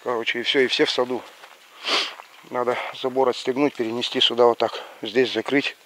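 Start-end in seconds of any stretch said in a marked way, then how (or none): none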